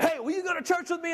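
put-on high, eager little boy asking; little kid voice. Little kid inviting the big guy, high and eager. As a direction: pipe it up like a small kid.

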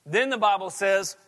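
emphatic pulpit reading of scripture; preaching at full force. Acted. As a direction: press the story home. Preaching, reading Elijah story.

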